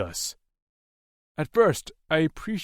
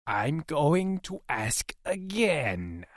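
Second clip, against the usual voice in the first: slow, higher, measured threat; menacing captor; 5 semitones higher, much slower than usual. Acted. Alien captor interrogating his prisoner, slow menace. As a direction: drag each word out as a warning. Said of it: "he said".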